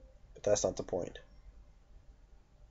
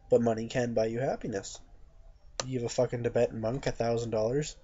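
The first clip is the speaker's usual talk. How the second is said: ordinary talk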